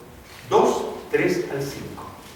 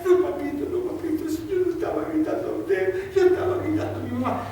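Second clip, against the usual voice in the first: put-on high, pleading little boy voice; niño asustado; 13 semitones higher than usual. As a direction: beg your father like a scared child. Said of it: "¿Y qué decía él?". (niño suplicándole a su papá, voz aguda y asustada)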